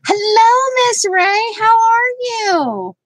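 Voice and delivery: cheery livestream hello to a viewer; sing-song greeting. Real, not acted. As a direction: greet a friend joyfully. Greeting live chat viewers.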